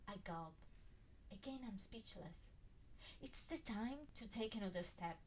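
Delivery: ordinary delivery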